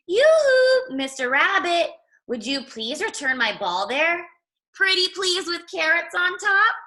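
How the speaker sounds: sing-song bird. Acted. Little bird calls to the rabbit for its ball, high sing-song. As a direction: trill it like a chirpy bird. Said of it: "would you like me to stop singing".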